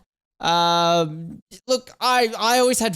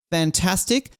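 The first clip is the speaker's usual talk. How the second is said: usual speaking voice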